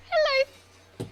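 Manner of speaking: very high squealed hello, trying to hold back; cutesy hello. Acted. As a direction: coo at the cute little geckos. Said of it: "But she's like".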